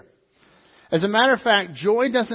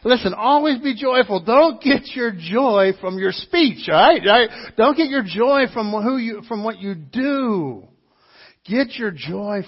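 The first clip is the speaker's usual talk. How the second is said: preaching at full force; 5 semitones higher, 8 dB louder than usual. Acted. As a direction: drive the point home to the congregation.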